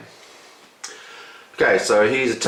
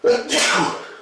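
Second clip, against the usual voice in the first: big sneeze. Real, not acted. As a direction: sneeze hard, then recover. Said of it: "I'm gonna do a massive sneeze".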